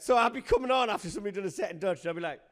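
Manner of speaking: normal talking voice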